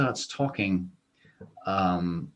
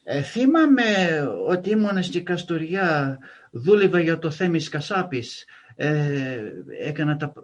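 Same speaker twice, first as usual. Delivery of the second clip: lightly voiced Greek father, pitched up; friend's Greek dad; 5 semitones higher, 3 dB louder than usual. Friend's dad recalling Kastoria over dinner, lightly imitated in higher voice. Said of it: "and his dad says".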